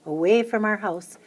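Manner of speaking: ordinary delivery, nothing marked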